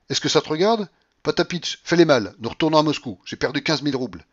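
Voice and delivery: high, loud irritated rebuke; snappish orders. A narrator's reading. Babouchka to Marfa after losses, snappish. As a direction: put the servants in their place. Mistress snaps at her servants.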